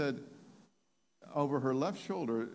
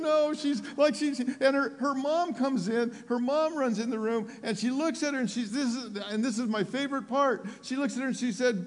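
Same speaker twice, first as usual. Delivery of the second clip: loud, high, excited build-up; full-force preaching; 14 semitones higher, 7 dB louder than usual. Retold or imitated. Preacher telling how her mom runs in, full-force preaching. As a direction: drive toward the favorite part at full pitch.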